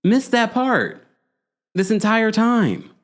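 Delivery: high, slow mock disbelief; incredulous high voice. Host mimicking puzzled listeners, high and incredulous. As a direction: stretch it out, high and baffled. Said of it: "people are like".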